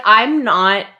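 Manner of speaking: ordinary delivery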